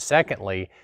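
normal talking voice

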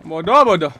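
loud frustrated scolding; annoyed yell. Real, not acted. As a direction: bark the insult twice. Gamer scolds teammate.

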